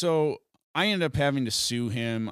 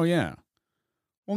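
ordinary talk

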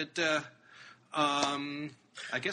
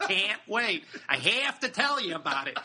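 put-on Chicago accent; 5 semitones higher than usual. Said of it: "he said in his best Chicago accent".

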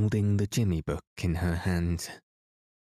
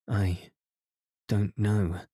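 halting, with a pause after "I"; stammering; slower than usual. A narrator's reading. Emily asked who drew the sketch, stammering slightly. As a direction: hesitate mid-sentence, mildly stammering. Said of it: "stammered Emily".

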